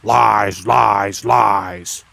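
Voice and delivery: low rasping mutter from somewhere unseen; a hidden goblin jeering in a hoarse voice; lightly voiced goblin jeer, hoarse and indistinct. A narrator's reading. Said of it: "in a hoarse, indistinct voice".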